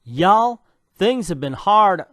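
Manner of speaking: loud, lightly put-on country twang; Southern country drawl on the recap; country accent. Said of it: "I started a country accent".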